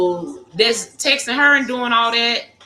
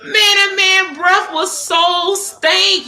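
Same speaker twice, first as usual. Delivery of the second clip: exaggerated disgust; 9 semitones higher, 4 dB louder, slower than usual. Real, not acted. Recalling date's bad breath.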